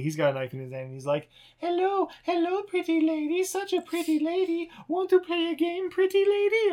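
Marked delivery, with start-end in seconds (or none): 1.6-6.7 put-on high, sing-song creepy kid; creepy child. Tommy the kid taunting the mom in a creepy voice. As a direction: taunt like a sinister little boy.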